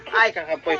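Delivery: ordinary talk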